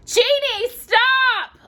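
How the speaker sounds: high desperate shout. Acted. Aladdin shouts at the genie, high and loud. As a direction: yell it to stop the genie. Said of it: "Aladdin shouted".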